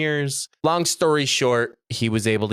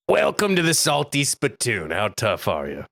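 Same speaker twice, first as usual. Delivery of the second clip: acted tough doorman greeting; salty spittoon bouncer. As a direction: challenge them at the door, dropping in pitch. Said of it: "I will say this once".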